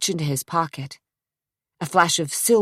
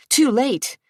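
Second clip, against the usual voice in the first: ordinary delivery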